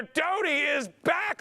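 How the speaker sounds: high, slow, stretched cry of delight; thrilled announcement. Real, not acted. Auctioneer telling the room a bidder is back, high and slow. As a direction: draw it out high as if the crowd should cheer.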